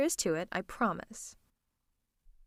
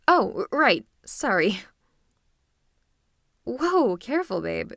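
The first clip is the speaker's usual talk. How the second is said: startled concern; 7 dB louder than usual. Acted. Startled, steadies stumbling spouse.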